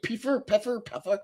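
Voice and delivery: "Pfeffer" tried several ways, high and soft; puzzled kid sounding-out. Retold or imitated. Streamer recalling his childhood guesses at "Pfeffer", high puzzled sounding-out. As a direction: sound out "Pfeffer" like a confused kid, vowel changing each try. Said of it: "I was only a kid. I'm like".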